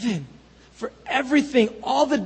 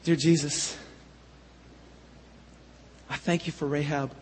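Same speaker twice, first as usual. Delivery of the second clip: soft, low closing prayer; 5 semitones lower, 5 dB quieter than usual. Real, not acted. Pastor praying over the congregation, soft and low. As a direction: pray it quietly, from the heart.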